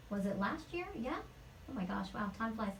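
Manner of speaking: soft, to herself while recalling; quiet aside. Real, not acted. Guest checking when she worked on the show, quiet aside. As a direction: drop the volume for a musing aside.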